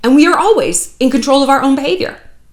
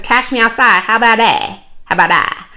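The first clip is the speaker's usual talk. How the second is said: quoting the catchphrase. Retold or imitated. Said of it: "super viral famous because".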